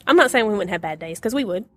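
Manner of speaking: ordinary talk, nothing marked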